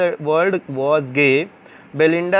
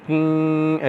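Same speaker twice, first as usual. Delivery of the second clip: humming like a buzzing bee; short sustained level hum; imitated bee buzz; much slower than usual. Shown as a demonstration. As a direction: hum on one note like a bee. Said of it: "ऐसे करके".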